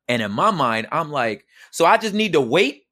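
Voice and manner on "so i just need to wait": high, loud exasperated disbelief; indignant outburst. Retold or imitated. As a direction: let the outrage climb as you replay it. Retold fight with wife.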